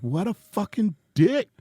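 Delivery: stung and offended, spitting it out; indignant outburst. Retold or imitated. Comedian retelling his reaction to Tone's insult, indignant.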